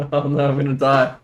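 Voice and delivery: ordinary talk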